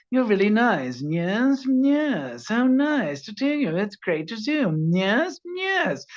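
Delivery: very high, drawn-out fawning voice; weird, creepy mannered. Acted. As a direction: impersonate a creepy, oddly mannered film character. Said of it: "this very weird mannered thing".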